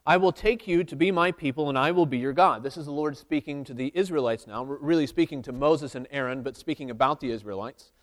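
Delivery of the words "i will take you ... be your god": louder, measured Bible reading; scripture reading. Acted. Preacher reading Exodus aloud to the church, steady and louder. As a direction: read the verse out to the congregation.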